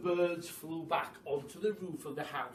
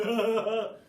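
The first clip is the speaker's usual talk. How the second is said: gloating laugh; 5 semitones higher, 9 dB louder than usual. A narrator's reading. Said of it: "said Mister Twit".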